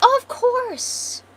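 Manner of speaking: very high, loud, drawn-out posh gush; posh queen. Acted. Posh queen agrees to help rescued children, gushing poshly. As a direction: swoop up grandly and stretch it out. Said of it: "she's a posh bitch".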